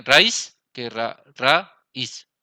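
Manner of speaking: "raíz" said slowly, the í held apart; slow teacher demo of "raíz"; slow syllable demo. Shown as a demonstration. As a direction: stretch "Raíz" so the í stands apart. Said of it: "se lee raíz".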